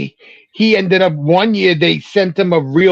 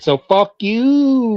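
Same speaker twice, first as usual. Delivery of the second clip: long, higher drawn-out kiss-off; dismissive mock taunt; 6 semitones higher, much slower than usual. Owner brushing off paying fans, mockingly dismissive. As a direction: stretch it out like a smug brush-off.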